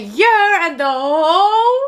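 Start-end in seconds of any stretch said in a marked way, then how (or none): 0.0-0.7 a higher, pumped "Yeah"; graduate's triumphant yeah; mock yell. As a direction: shout it into the mic. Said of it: "grabbed the mic, and said".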